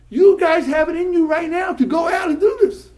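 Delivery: high, loud rallying shout; impassioned exhortation. Real, not acted. Rabbi urging his students to go out and do this, shouting passionately. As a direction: rouse them at full force.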